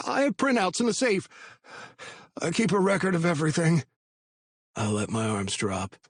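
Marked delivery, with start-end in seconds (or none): 0.1-3.9 high, fast anxious babble; nervous rambling. A narrator's reading. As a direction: blurt it out in a panic. Patty blurting.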